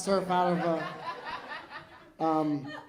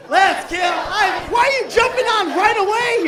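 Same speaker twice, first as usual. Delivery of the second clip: high, loud comic yelling; over-the-top mock-angry shout; mock outrage; 10 semitones higher, 10 dB louder than usual. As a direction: yell it in hammed-up indignation. Mock outrage at audience member.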